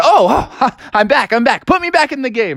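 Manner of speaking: high, excited gasping comeback; eager revival. Acted. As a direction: wake with a start and beg to play. Sleeping Beauty wakes eager.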